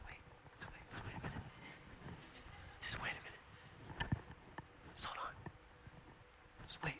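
urgent hushed whisper; whispered calm. Retold or imitated. The Lord calming Peter and Andrew, whispering. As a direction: hush them gently, wait, wait. The Lord quietly calms the disciples.

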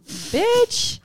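high, sharp mock-indignant bitch; fake-furious Florida attitude; mock outrage. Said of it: "I'm like".

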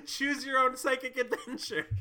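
high laughing delivery. Real, not acted. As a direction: laugh through the words. Laughing at idea.

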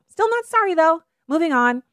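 high, sing-song brush-off; smug dismissive mock. Offender brushing off the hurt they caused, smug sing-song. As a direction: toss it off like it's nothing. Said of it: "Then they're like".

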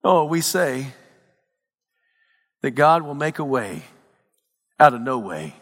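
usual speaking voice

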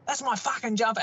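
high mock-outraged catchphrase; quoting angry dad. Son repeating the family in-joke, imitating dad's outrage. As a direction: replay Dad's indignant line as an in-joke. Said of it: "we'll still say".

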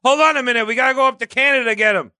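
lifted, hurried voice of a soldier in on the joke; mock soldier voice. Friend mocks a Civil War soldier's excuse, high and hurried.

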